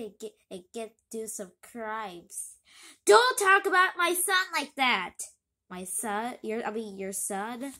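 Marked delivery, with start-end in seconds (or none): 3.1-5.1 louder, higher protective snap; angry rebuke. Acted. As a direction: defend your son, sharp and firm. Father defends son angrily.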